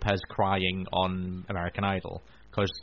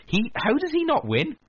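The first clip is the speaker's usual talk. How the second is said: incredulous. Retold or imitated. Exasperated at talent show judges.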